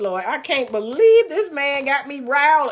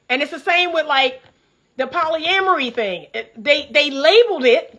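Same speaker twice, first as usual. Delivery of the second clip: ordinary talk, nothing marked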